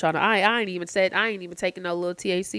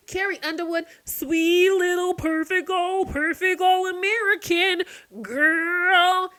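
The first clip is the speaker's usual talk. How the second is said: much higher, slow, sugary mock praise; mocking sweetness; 11 semitones higher, 5 dB louder, slower than usual. Vlogger mocking the singer's wholesome image, high sarcastic coo.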